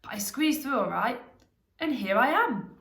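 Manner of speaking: a touch of triumph; pleased hobbit. A narrator's reading. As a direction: lift the final words proudly. Bilbo proudly reports his escape.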